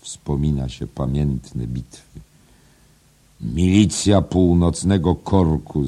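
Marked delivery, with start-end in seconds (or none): 3.4-5.9 slow, raised proclamation; called out. A narrator's reading. Editor recalling famous battles, calling out. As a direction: call it out higher and louder, drawn out. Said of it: "zawołał naczelny".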